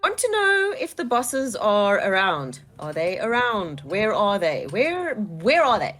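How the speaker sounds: drawn-out, deliberate interrogation; slow firm questioning. Acted. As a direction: press the goblin slowly. Orc interrogating goblin.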